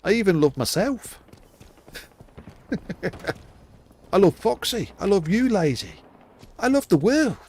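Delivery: gushing joke. Real, not acted. Gushing love list, then lament.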